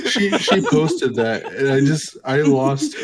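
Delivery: laughing while talking. Real, not acted. Telling friends how the post broke them, real laughter. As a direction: try to explain through giggles.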